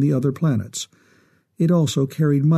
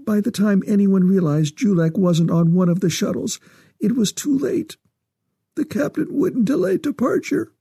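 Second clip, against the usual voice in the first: high-pitched, distraught mother; tearful woman's voice, rising toward breaking; close to tears; 8 semitones higher than usual. A narrator's reading. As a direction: climb higher as the tears come, then trail off. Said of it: "She trailed off, her eyes filling with tears".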